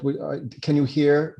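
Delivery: normal talking voice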